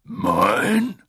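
leicht gespielter gutmütiger Riese; Hagrid. A narrator's reading. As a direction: freundlich brummiger Wildhüter. Hagrid figure voiced by narrator. (Hagrid, gutmütig und stolz)

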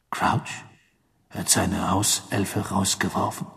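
hushed, slow, breathy questioning; Sirius, brooding. Acted. Sirius takes notice of the fired elf, brooding. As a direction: think aloud slowly and softly.

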